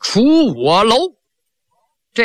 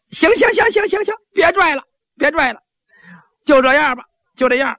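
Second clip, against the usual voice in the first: much higher, frantic stop-stop; panicked pleading; 8 semitones higher than usual. Acted. Yan Er begs the servants to stop tearing the skin, high frantic. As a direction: beg them to stop pulling, squealing.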